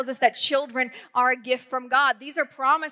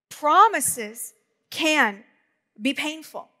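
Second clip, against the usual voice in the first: slow emphatic repeat; much slower than usual. Shown as a demonstration. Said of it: "Because promises can be painful".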